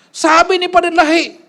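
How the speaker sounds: high, slow, sing-song mockery of "Padre Lai"; sneering imitation of the rival; mocking quote. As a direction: stretch "Padre" up high to ridicule it.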